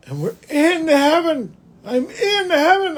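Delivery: high, breathless wonder; awed excitement. Retold or imitated. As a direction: lift up in delight at heaven. Vision of heaven.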